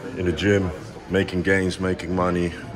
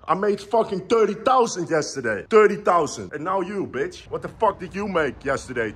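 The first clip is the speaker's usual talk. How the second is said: raised, pushing motivational rant; heated rant; 8 semitones higher than usual. Real, not acted. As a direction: lecture the lazy viewer hard.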